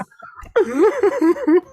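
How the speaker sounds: high, comic sobbing wail; mock bawling. Elf overwhelmed by appreciation, mock bawling. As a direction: burst into hammed-up sobs. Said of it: "just fully just starts bawling".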